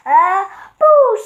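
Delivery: high, loud sudden boo; shouted boo. Acted. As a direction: leap out and shout it. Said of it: "shouted Little Gray Mouth".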